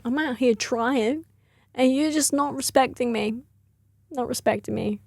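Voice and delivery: lightly voiced offended bird, dragging the words; falcon impression. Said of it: "This is my impression of a falcon".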